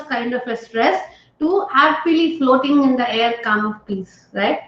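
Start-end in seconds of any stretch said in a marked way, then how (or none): none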